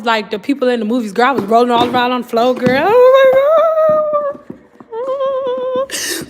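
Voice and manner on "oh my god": hammed-up high wail, much louder; dramatic sung-out wail, imitating grief; mock movie wail. Retelling rolling on the floor.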